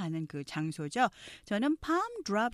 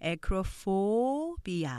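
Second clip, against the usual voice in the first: slow "acrophobia" with long, strong PHO; third-syllable stress; much slower than usual. Shown as a demonstration. Teacher modelling accent for learners, "acrophobia" stressed on PHO. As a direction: stretch the PHO in "acrophobia", acro-PHO-bia. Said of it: "세 번째 액센트가 있는".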